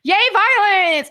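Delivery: high, loud gleeful cheer; mock cheer. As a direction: cheer it like a delighted fan. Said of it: "I was like".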